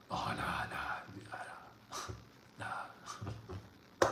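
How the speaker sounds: whispered, timid oh no no; mock whisper. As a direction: breathe the doubt out like a scared inner voice. Mocking inner self-doubt.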